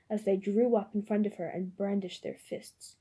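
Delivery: ordinary delivery, nothing marked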